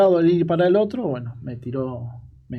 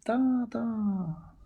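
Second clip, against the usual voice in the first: high, drawn-out mock shout, kept soft; imitated distant calling; 7 semitones higher, 5 dB quieter than usual. Son imitating his father shouting on arrival, high drawn-out mock yell. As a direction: call out high and long without real volume. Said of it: "venía a los gritos, el viejo".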